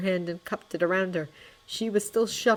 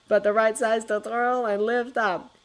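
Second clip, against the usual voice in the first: ordinary delivery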